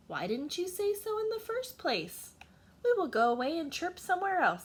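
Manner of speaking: high, quickening cricket chorus voice; cheerful crickets. Acted. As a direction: chirp it out, happily agreeing.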